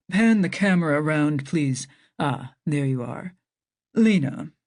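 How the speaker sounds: brisk man taking charge of the call; low confident doctor addressing Lena on video; Doctor Harris voice. Acted. Doctor Harris voiced lower throughout.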